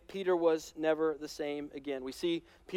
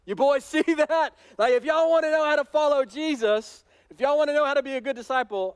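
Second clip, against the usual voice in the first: louder and higher, boasting with a laugh; cocky brag; 7 semitones higher, 5 dB louder than usual. Retold or imitated. Peter brags to the other disciples, loud and laughing. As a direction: show off to the boys after a win. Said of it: "be like".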